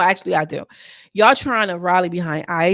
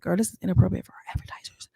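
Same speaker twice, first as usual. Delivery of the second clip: fast whispered prissy warning; mock YouTube whisper; 5 dB quieter, faster than usual. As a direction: hush it like a nervous censor. Mocking YouTube's flagging voice.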